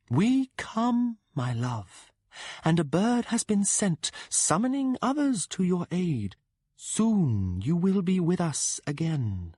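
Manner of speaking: voce alta e distante, promessa lenta e suadente; la cavalcatrice del gatto rassicura il suo amato, lenta e possessiva; voce della donna gatto. Acted. Cat-rider woman speaks.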